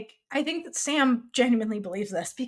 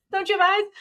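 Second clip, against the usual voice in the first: higher, mock disbelief; incredulous jab; 9 semitones higher than usual. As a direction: toss it off like it's obvious.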